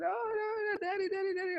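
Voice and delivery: put-on high, soft childlike wail; imitating crying daughter. Dad mimicking his injured daughter, high and soft. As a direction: whimper like a hurt little girl. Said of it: "She's like".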